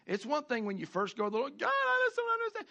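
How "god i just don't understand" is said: much higher, pleading cry to God; desperate prayer. Acted. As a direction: plead like a confused believer.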